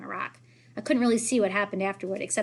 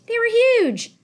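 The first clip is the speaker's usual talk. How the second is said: high, loud exclamation; excited; 10 dB louder, much slower than usual. Acted. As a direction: blurt it out amazed. Excited retelling.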